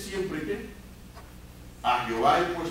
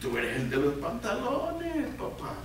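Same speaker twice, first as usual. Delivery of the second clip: low, softer voice of conscience; a man's conscience telling him he is the boss; 5 semitones lower, 6 dB quieter than usual. Retold or imitated. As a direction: murmur it like an inner voice egging him on. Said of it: "nuestra conciencia nos dice".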